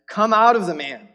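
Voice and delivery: loud, high command; Jesus ordering the unclean spirit out. Acted.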